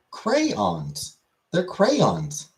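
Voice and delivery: crayons said as cray-ons, every letter sounded, pitched high; crayons in three syllables. Shown as a demonstration. Reading chat's spelling, streamer says crayons as cray-ons. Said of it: "But there's a Y in there. And an O".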